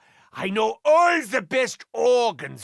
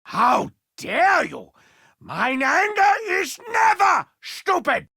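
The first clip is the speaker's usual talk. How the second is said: offended shout; 7 semitones higher than usual. Acted. Offended retort.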